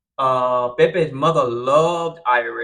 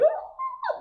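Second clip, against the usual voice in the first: mock silent scream. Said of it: "They wanna scream".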